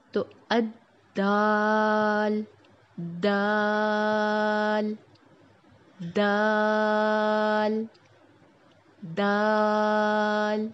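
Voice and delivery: دال with a firm start, stretched very long; drawn-out dal. Shown as a demonstration. Teacher models دال slowly for students, firm and drawn out. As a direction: hit the د firmly and hold دال for four counts. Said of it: "start ... سختی سے ہوتا ہے".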